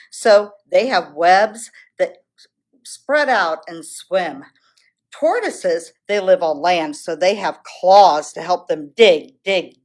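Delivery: ordinary delivery, nothing marked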